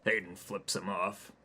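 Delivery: usual speaking voice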